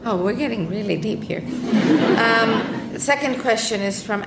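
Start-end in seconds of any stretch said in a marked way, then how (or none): none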